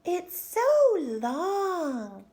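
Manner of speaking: mocking. Classmate Jo teases Chrysanthemum about her long name. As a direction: stretch it out to tease.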